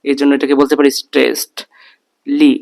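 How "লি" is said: usual speaking voice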